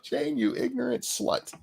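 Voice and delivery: high mock newsman delivery; hammy high-pitched anchor put-down; SNL anchor impression. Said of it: "made me think of".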